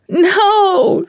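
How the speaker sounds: very high, piercing cry of despair; anguished scream. A narrator's reading. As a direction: scream it from the gut. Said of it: "screamed Catherine".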